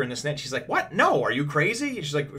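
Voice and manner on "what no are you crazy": higher, louder retold disbelief; incredulous refusal. Doctor refusing to take over a colleague's work, incredulous. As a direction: balk at the request in mock outrage.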